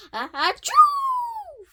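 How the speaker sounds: achoo stretched into a high, loud howl; huge howled fake sneeze; wolf-like sneeze. A narrator's reading. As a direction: sneeze out a long howling "choo". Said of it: "I sound like a wolf".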